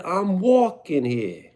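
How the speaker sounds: slow, high New York accent, leaning on "walking". Shown as a demonstration. Streamer repeats New York accent for chat. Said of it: "I said".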